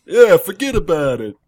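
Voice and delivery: loud, slow, hammed-up Brooklyn tough guy; mock Brooklyn accent. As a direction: bellow it like a Brooklyn wiseguy. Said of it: "Does everyone talk like a jackass in Brooklyn?".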